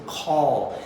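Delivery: teacher modelling call; closed vowel. Shown as a demonstration. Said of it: "É um som bem fechado mesmo".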